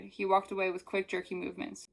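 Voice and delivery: ordinary delivery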